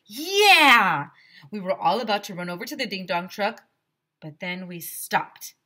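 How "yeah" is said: high, loud burst of a cheer. Acted. Kids cheer about ice cream, loud high yell. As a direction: yell it with the whole class.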